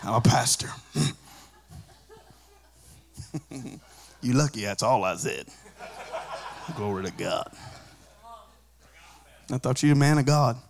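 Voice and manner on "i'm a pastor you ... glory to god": soft, chuckling, sheepish; laughing at himself. Real, not acted. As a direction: laugh it off quietly. Laughing at himself after slip.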